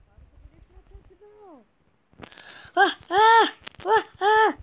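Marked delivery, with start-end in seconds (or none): 2.7-4.6 high, loud repeated "ah"; excited yelps. Real, not acted. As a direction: yelp as the play unfolds. Reacting in game. (ενθουσιασμένες κραυγές)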